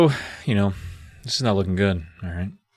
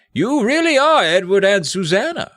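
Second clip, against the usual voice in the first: high, theatrical British elder; Morgred; 10 semitones higher, 3 dB louder than usual. Acted. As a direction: proclaim it grandly as an old sorcerer. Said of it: "Morgred replied".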